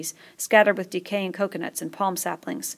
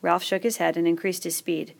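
usual speaking voice

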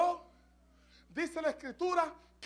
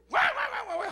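yapping dog noises, high; barking like a dog; 11 semitones higher, 5 dB louder than usual. Pretend. Preacher mocks noisy praise with barking. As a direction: bark it out like a playful dog.

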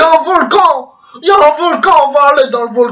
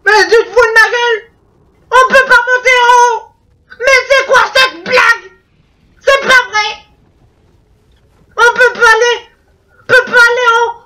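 higher-pitched indignant complaining; outraged disbelief; 8 semitones higher than usual. Real, not acted. To the game when he cannot climb up, high outraged complaining. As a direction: protest like the game cheated you.

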